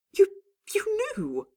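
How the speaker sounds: loud, high, stammered accusation; slow, stretched cry of accusation; accusing. A narrator's reading. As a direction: burst out accusingly, voice leaping up. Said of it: "Dorian accused".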